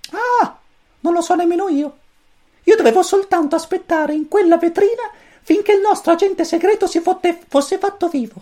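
very high, squeaky little chick voice; cosmic chick voice. A narrator's reading. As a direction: chirp it like a tiny alien chick. Pulcino cosmico parla. (vocina acutissima da pulcino)

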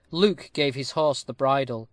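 ordinary delivery, nothing marked

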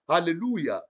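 normal talking voice

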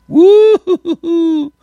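gleeful falsetto hoot at the rival's loss; mock cheer. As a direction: whoop it up in a high sing-song. Cheering Buffalo losing.

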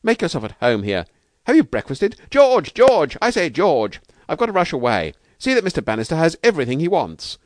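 high, loud, delighted outpouring; overjoyed. A narrator's reading. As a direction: gush thanks, then rush to get away. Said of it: "stammering in his delight".

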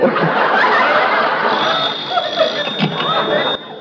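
high, breathy whooping laugh at his own pun; big laugh. Acted. Actor laughing at his own bad joke, high whooping laugh. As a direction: cackle away, delighted with yourself.

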